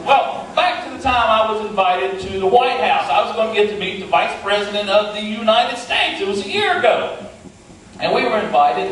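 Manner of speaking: ordinary talk, nothing marked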